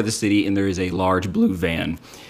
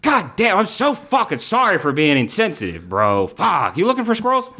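high, eager hustler voice; squirrel dealer; 13 semitones higher than usual. Acted. Tracksuit dealer greeting the unicorn in a high hustler voice. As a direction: greet him like a sleazy pal.

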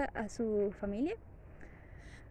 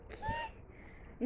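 surprised; 11 dB quieter than usual. Retold or imitated. Dad hears they're locked in.

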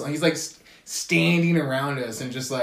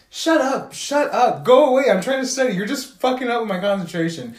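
higher and louder, a fed-up telling-off; mock angry shout; 7 semitones higher, 4 dB louder than usual. Speaker telling the classmate to go away, a mock angry shout. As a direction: drive him away loudly.

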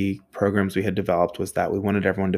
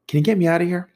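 mock plea, pitched way up; high-pitched plea; 10 semitones higher, 4 dB louder, much faster than usual. Driver asking the maintenance man for rescue, high plea. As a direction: ask it high, as if pleading for rescue.

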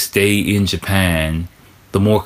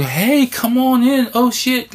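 high, hammed-up welcoming host voice; mock Japanese host; slower than usual. Host greeting a foreign guest, high mock welcome. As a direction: gush a high-pitched welcome at the door. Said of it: "Japanese people be like".

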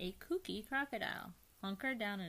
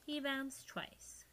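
plain reading voice

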